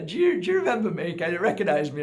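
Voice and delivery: lightly voiced eager woman; woman's voice.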